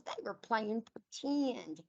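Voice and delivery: ordinary delivery, nothing marked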